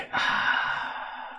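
long, breath-only sigh of resignation; weary sigh. Acted. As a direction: let out a long, deflated sigh. Acts out reaction to wasted spell.

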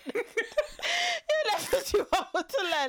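drawn out slowly through laughter; laughing, slow repeat of the saying. Real, not acted. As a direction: savour it while laughing.

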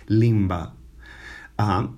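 ordinary talk, nothing marked

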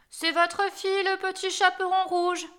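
high, put-on little-girl voice; wolf's falsely girlish high pitch; wolf disguising his voice. A narrator's reading. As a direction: fake a child's voice to fool granny. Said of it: "dit le loup en contrefaisant sa voix".

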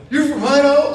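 high, loud, incredulous "Idaho?"; mocking surprise. New York cop teasing the Idaho driver, loud and high. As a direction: act amazed to tease him.

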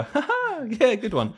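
high, forced polite laugh, then a hollow compliment; fake laugh. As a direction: pretend you find it funny. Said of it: "my fake laugh".

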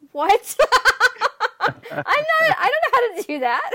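loud, high-pitched mock bafflement, laughing; retold disbelief. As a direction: act out a baffled protest, voice leaping up through laughter. Retold baffled reaction to accent request.

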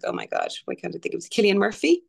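usual speaking voice

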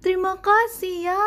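put-on boy thanking father for the hat; happy thanks. A narrator's reading. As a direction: thank dad brightly. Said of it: "seru Douglas".

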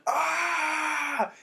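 long, high, loud scream; sustained mock yell at top pitch; imitated scream. Friend screaming in the pool, long high yell. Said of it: "he was just screaming".

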